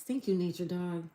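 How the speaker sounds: ordinary talk, nothing marked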